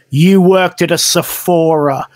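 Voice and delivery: low, slow taunt. Retold or imitated. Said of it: "leans right up into her face and goes".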